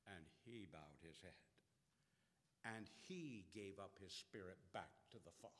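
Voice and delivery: soft, low and subdued; hushed. Real, not acted. Preacher softly describing Jesus' death to the congregation. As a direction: drop to a near hush. Hushed after the shouted cry.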